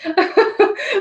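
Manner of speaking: short, high laugh at herself; embarrassed laugh. Real, not acted. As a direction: laugh off the hiccups. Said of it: "I have the hiccups".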